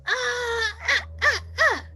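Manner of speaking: high cathartic yells; demo yell. Shown as a demonstration. Showing how to yell out anger, high cathartic yells. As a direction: let out frustrated shouts, high. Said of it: "yell at the same time".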